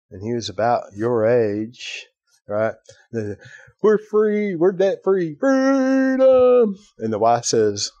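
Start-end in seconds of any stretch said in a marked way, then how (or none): 3.8-5.3 high-pitched mock cheering; celebrating callers. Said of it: "Gets a husband on the line". 5.3-6.8 high, loud, long-held mock cry; Braveheart yell. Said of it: "he yells out".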